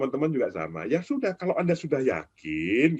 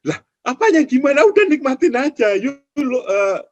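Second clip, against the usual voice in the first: high, louder exasperated scoff; amused exasperation, pitched up; exasperated retort; 10 semitones higher, 4 dB louder than usual. Real, not acted. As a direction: brush off the silly worry, amused. Exasperated reply to traders.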